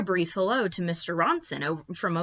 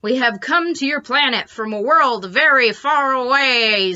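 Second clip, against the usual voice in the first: higher, louder proclamation; alien announcement; 6 semitones higher, 7 dB louder than usual. Acted. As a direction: boom it like grand invaders.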